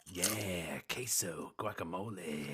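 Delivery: low, soft, drawn-out ASMR cues. Pretend. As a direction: murmur each word for the crunch. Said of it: "crunch right after I say".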